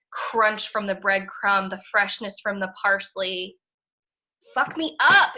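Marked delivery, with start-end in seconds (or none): 4.5-5.3 a loud, higher burst of delight; thrilled outburst. Real, not acted. Host raving to co-host about the pasta, loud delighted outburst. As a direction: blurt it out like the food just floored you.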